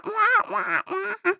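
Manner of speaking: high droning wah-wah voice; mock your own voice with high, drawn-out wahs; sad-trombone style whine; whiny mocking "wah". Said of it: "Mine's like".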